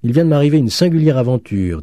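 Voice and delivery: put-on animated young storyteller at dinner; student telling his news. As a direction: announce your strange adventure to the table. Narrator voices the student.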